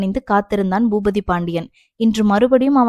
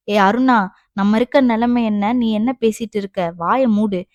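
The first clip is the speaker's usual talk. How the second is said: ordinary delivery, nothing marked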